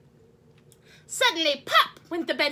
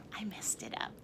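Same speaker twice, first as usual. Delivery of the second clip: a quick unvoiced aside; whisper; 6 semitones lower, 14 dB quieter, faster than usual. Real, not acted. Reader flubs the song.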